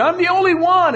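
whiny Elijah. Retold or imitated. Elijah complaining, voiced quote.

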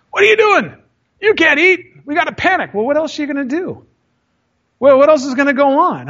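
high, frantic mock panic. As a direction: ham up the scared crew begging everyone to panic. Mocking panicked diners at sea.

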